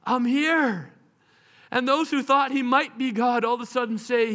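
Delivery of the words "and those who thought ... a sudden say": ordinary talk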